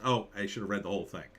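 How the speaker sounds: ordinary talk, nothing marked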